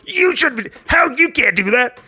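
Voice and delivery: very high, slow mock scolding; generic objector voice. Host mocking cohost's voice for critics, high put-on scold. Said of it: "you're the generic voice of everybody".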